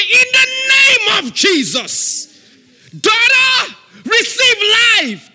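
high, loud, slow command; commanding shout. Shown as a demonstration. Preacher showing bold prayer over a sick daughter, shouting. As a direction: declare it like a general giving orders. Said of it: "You say".

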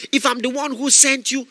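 ordinary talk

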